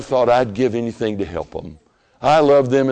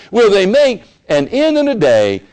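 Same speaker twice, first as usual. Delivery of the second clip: mocking taunt; 9 semitones higher, 7 dB louder than usual. Repeats the taunt.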